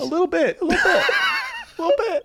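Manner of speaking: high, laughing repeats of the phrase. Real, not acted. Comedian laughing off being hard on himself, laughing. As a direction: giggle it out sheepishly. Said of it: "you're hard on yourself sometimes".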